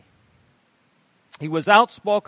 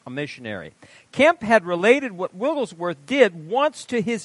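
animated sermon reading; 6 semitones higher, 7 dB louder than usual. Acted. Preacher reading a testimony aloud.

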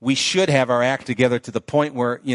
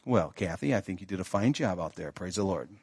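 muttering to himself; 5 semitones lower, 9 dB quieter than usual. Retold or imitated. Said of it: "I made a verbal comment to nobody".

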